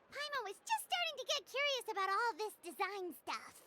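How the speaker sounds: ordinary talk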